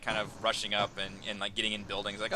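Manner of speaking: ordinary talk, nothing marked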